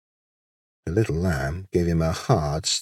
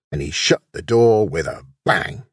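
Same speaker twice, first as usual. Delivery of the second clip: ordinary delivery, nothing marked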